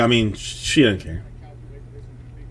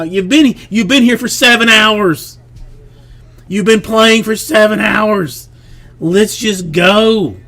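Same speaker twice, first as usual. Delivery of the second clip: higher, louder worn-out parent; exasperated pleading; 8 semitones higher, 4 dB louder than usual. Retold or imitated. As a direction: plead at the end of your rope. Exasperated dad pleading with child.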